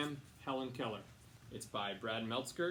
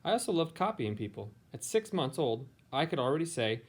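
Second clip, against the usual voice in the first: clear, louder storybook reading voice; reading to children; 10 dB louder than usual. A narrator's reading. Teacher reading a picture book to her students, storybook voice. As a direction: read it aloud to a class of kids.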